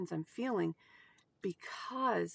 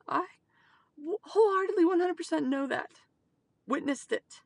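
emotional; 7 semitones higher, 4 dB louder than usual. Real, not acted. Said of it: "I am emotional now".

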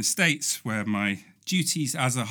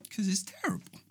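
whisper; 6 semitones higher, 12 dB quieter, faster than usual. Real, not acted.

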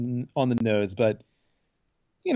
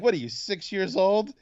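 higher-pitched mock reproach; retold scolding; 9 semitones higher than usual. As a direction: scold him as if he were a child, in retelling.

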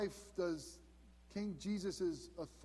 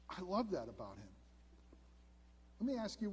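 usual speaking voice

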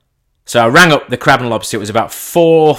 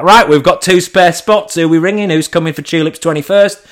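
excited rallying; 6 semitones higher, 5 dB louder than usual. Retold or imitated. To his friends after the call, triumphant and loud. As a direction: round up two more guests, triumphant.

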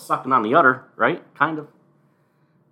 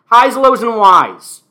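louder, higher announcer call of highs, lows, and whys; announcer intro; 8 semitones higher, 13 dB louder than usual. Acted. Host introducing his segments, a loud announcer call. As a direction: boom it out like a show host. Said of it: "introduce the segments".

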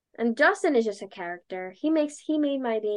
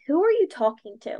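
confused, a little louder, exactly as it came out; mildly louder blurted question; 4 semitones higher, 5 dB louder than usual. Retold or imitated. Asking the station guy which caller she was on with, a slightly louder blurt. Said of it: "literally like that".

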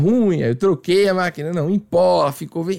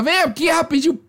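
high, quick summons, lightly acted; lightly voiced waxer; 9 semitones higher than usual. Waxer calling client back, lightly voiced and brisk. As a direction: imitate her hurrying the client over.

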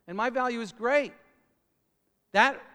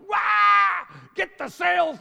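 panicked shout, much higher and louder; screaming my head off; 10 semitones higher, 9 dB louder than usual. Retold or imitated. Speaker on a boat in a storm, yelling at Jimmy in a panic. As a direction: yell for the sails in a storm. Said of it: "screaming my head off".

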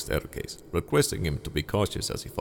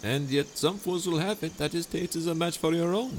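higher, sweetly scheming host voice; honeyed charm pitched up high; Montresor, falsely friendly; 11 semitones higher than usual. Acted. Montresor dialogue.

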